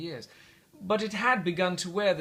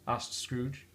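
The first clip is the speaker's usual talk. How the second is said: usual speaking voice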